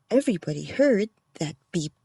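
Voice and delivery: usual speaking voice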